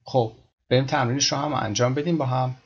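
ordinary delivery

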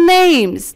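fuse name and is into one long "names"; contracted name's. Shown as a demonstration. Teacher showing how name is gets joined, drawn-out "names".

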